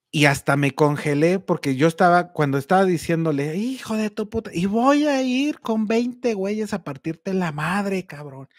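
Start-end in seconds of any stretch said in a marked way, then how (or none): 3.6-8.5 higher, slow, drawn-out retold threat; mock yell at the thief over the phone; angry yelling. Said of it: "todos esos gritos".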